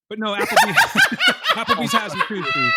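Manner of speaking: words broken by laughter; laughing. Real, not acted. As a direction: giggle through the words. Laughing through correction.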